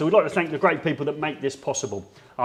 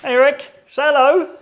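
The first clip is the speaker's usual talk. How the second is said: excited greeting; 12 semitones higher, 9 dB louder than usual. Real, not acted. Greeting Rick.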